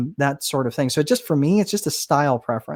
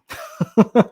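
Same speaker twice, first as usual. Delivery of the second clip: short laugh; 7 semitones higher, 4 dB louder than usual. Real, not acted. Joking about a punishing bet.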